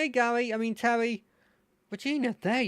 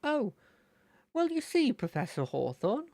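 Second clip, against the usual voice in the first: Terry's voice; 7 semitones higher than usual. Shown as a demonstration. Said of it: "Oh trigger phrase".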